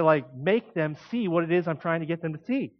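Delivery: usual speaking voice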